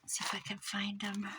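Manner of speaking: soft whisper to herself. Real, not acted. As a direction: whisper it while crafting. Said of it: "whispered to herself".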